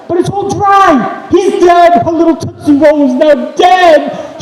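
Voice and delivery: high, loud, slow panicked shout; a mother howling that her boy is dead; frantic shriek. Retold or imitated. Mother shrieking over bloody son.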